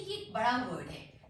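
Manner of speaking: usual speaking voice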